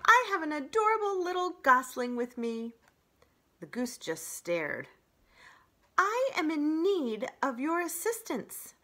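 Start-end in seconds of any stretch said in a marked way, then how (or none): 0.0-2.7 loud, higher cheerful call; robot calling to the old goose, loud and friendly; friendly boom. Acted. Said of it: "friendly voice boomed". 6.0-8.7 louder, higher polite robot; robot calling across pond, loud and polite. Acted. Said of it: "robot calling goose".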